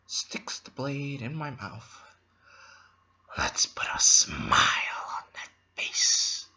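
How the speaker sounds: menacing hushed villain monologue; imitating the Joker telling his scar story; Joker impression. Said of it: "the way he portrayed him, the voice".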